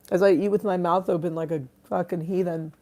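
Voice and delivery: normal talking voice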